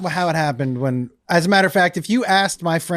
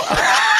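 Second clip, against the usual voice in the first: high, shouted laugh. Real, not acted. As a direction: let out a big yelping laugh. Reacting to his own joke.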